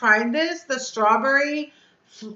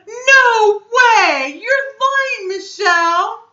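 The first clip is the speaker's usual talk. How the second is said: gasp, then high, loud, drawn-out squeal; real shock, voice leaping up loud; stunned disbelief; 10 semitones higher, 10 dB louder, much slower than usual. Real, not acted. Streamer stunned by Michelle's surprise gift, shrieking in disbelief.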